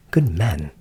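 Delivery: crying out. Acted. Frightened Hans appealing to the countryman, crying out. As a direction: call out to the man, pleading. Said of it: "cried he".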